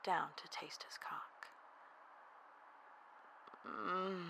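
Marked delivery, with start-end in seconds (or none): 3.7-4.2 higher, drawn-out "mm"; long appreciative murmur; savouring hum. Acted. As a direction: hum with pleasure. Narrator savours within the story.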